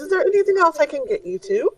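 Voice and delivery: a dainty merchant offering a fix; high shopkeeper voice. Acted. Shopkeeper assuring the party, high and fussy.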